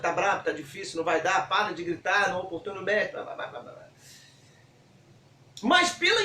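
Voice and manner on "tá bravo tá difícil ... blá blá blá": low, softening run of dismissals; mock discouraging naysayers. Crowd telling the blind man to give up, dismissively. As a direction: rattle off discouragement, fading into low blah-blah.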